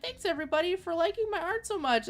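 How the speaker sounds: sweet artist voice. Retold or imitated. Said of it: "she's like".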